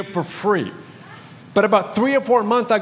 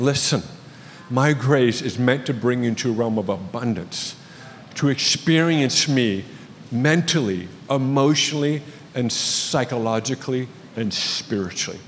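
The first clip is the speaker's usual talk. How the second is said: voice of the Lord; 8 semitones lower, 4 dB quieter than usual. Retold or imitated. Pastor voicing the Lord to the congregation, low and hushed. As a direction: lower and softer, a calm divine voice. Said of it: "And the Lord is saying".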